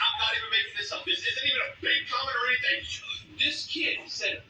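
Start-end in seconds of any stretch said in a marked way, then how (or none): none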